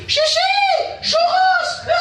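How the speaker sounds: put-on frantic kid crying out for food; gamin affamé. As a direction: shriek it like a starving child. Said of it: "comme s'il n'avait pas mangé depuis une semaine". (cri de gamin affamé)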